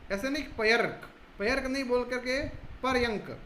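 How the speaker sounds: पर्यंक said "par-yank" with the r before the y, not पर्यक. Shown as a demonstration. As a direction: put the r ahead of य in पर्यंक. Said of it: "य से पहले बोला जाएगा".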